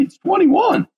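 sudden high, loud cheer; excited cheer. Real, not acted. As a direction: shout it out like a toast. Host cheers episode number.

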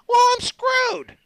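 very high, very slow shrug; imitating Scott. Scott answering his mechanic, high and unbothered. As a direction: say it high and slow, not caring. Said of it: "I'm all".

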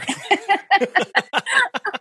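bright giggle; high laugh. Real, not acted. Laughs at own boast.

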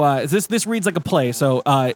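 ordinary talk

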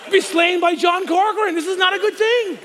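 high and loud frantic rant; comic panic. Retold or imitated. Son panicking about his biker dad in church, high and loud. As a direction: build into a shouting, laughing panic.

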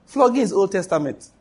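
normal talking voice

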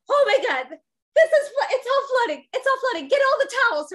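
high, loud panicked yelling; screaming. Retold or imitated. Husband screaming at mother-in-law about the flood, high and loud. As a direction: yell in panic as the water spreads. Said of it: "screaming at my mother".

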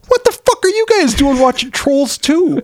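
very high-pitched mock outburst; incredulous retold squawk of protest; retold outraged disbelief. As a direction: retell your appalled reaction, voice shooting up. Retold outburst at his son.

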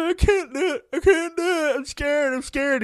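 put-on high, whining scared little kid; scared kid whine. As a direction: whimper it like a frightened child.

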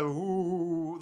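mock ghost ooh, drawn out; ghostly moan. Podcaster mocking Moaning Myrtle as a spooky ghost, mock ghost moan. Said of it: "no, it's moaning like".

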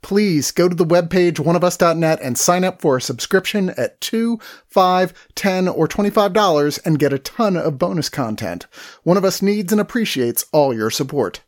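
loud, punchy subscription pitch; sponsor read. Acted. Host pitching subscriptions to listeners, upbeat ad read. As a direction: sell the membership hard.

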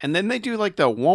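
ordinary talk, nothing marked

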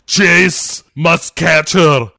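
mock Austrian accent; Arnold robot voice. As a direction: chant it like a terminator. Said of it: "Other than".

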